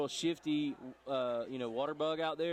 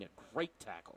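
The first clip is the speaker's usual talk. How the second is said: GREAT exaggerated, soft and low-key; teasingly overemphasise GREAT in a quiet echo; mock misplaced emphasis; 3 semitones lower, 6 dB quieter than usual. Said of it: "Put the emphasis on the wrong syllable".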